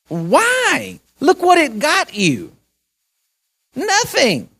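high, slowed, rising up to a shocked "Nothing"; exasperated pleading. Retold or imitated. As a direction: plead with her in disbelief. Pleading with the bitter old lady.

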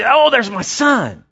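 a father's aching relief at the sight; the Father seeing his son return, slow and tender; yearning. Retold or imitated. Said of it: "to just yearning".

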